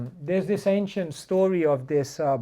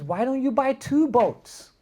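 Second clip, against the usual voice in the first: higher, slower salesman pitch; pushy businessman; 9 semitones higher, 5 dB louder than usual. Retold or imitated. Businessman urging the boatman to expand, pitched high. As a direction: sell the idea to the boatman. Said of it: "So the businessman goes".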